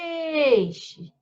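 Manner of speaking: PEIXE with a long, high PEI; "Peeei-xe", PEI held; elongated stressed syllable. Shown as a demonstration. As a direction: call out peixe, stretching the first syllable. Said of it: "a sílaba mais alongada".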